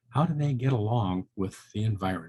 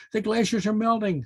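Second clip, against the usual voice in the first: high squeal as Harry Hare; 8 semitones higher than usual. A narrator's reading. Hare tells the monarch the news, in a high squeal. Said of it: "squealed Harry Hare".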